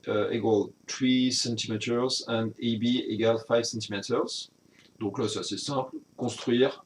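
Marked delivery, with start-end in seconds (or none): 0.1-4.5 English read slowly with a heavy French accent; French-accented English read aloud carefully. Real, not acted. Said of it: "vous avez profité de mon accent".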